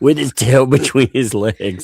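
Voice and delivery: put-on crowing voice; mock gloating. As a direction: finish the mocking quote through your amusement. Mock quote resumes after laugh.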